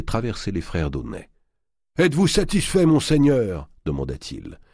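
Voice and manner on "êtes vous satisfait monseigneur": boatman voice. Acted. Boatman speaking.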